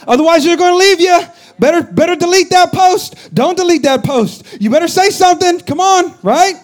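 high, loud, panicky warnings; alarmist inner voice. Acted. The fearful brain nagging the congregation, high loud alarm. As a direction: shout the warnings like a frightened brain. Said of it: "your amygdala is firing".

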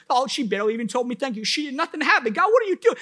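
high-pitched, rushed indignant rant at God; comically exasperated complaint to God; mad, arguing. Retold or imitated. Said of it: "I'm mad".

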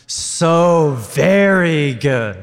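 very slow, loud, stretched words; drawn-out loud so very good. Real, not acted. Leading the crowd's drawn-out shout, loud and very slow. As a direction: stretch every word out loud.

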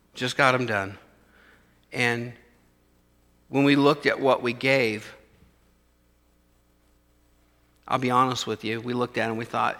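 low, soft, honest personal admission; quiet confession. Real, not acted. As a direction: speak it quietly, like a shared secret.

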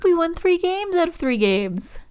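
high, loud and triumphant; proud announcement. Real, not acted. Player boasts to co-host of tournament wins, triumphant. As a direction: crow it with excitement.

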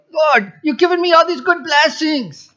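high, put-on foreign accent; a silly high accent for Abraham; mock accent. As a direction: ham up a funny foreign voice. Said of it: "maybe that's not the right accent".

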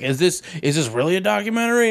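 high, quick, mock-credulous question; wide-eyed viewer. Viewer asking if Blair Witch is real, mock credulous. Voicing a gullible audience.

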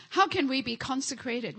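normal talking voice

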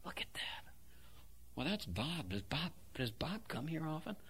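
whispered gossip about a newcomer. Retold or imitated. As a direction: hiss it behind a hand in church. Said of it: "they'll go".

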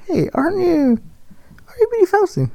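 high-pitched fan approaching a star; excited fan. Acted. Fan spotting an actress in the shop, high-pitched questioning. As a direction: pipe up high, as a curious shopper.

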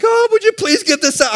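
high-pitched mock plea, like a big baby; big old baby. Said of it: "I am a big old baby".

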